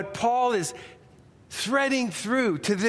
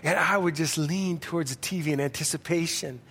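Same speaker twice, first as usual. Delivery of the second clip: ordinary talk, nothing marked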